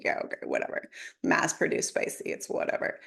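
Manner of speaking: breathy, bored under-the-breath shrug; unimpressed. Retold or imitated. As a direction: toss it off as if nothing could be spicy. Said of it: "I'm like".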